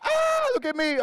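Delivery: very high, loud squeal; imitating a loud extrovert crying ah look at me; mock show-off. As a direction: squeal it like an attention-seeker. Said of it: "You're just like".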